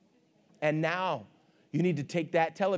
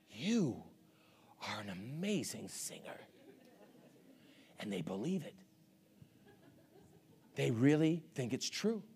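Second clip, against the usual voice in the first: hushed aside; 6 semitones lower, 12 dB quieter than usual. Acted. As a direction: drop very low and soft, almost under the breath. Mock confiding of false praise.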